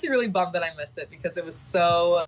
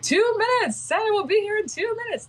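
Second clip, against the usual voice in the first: much higher, louder cry over the PA; excited announcement; 4 dB louder than usual. Retold or imitated. Captain over the PA calling out that Santa is near, excited shout. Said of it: "It's like".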